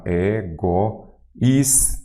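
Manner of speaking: "Egoísta" drawn out as e, go, ís, ta; very slow "Egoísta", a pause after each syllable. Shown as a demonstration. Teacher dividing a word for students, "Egoísta" syllable by syllable. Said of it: "Vamos lá".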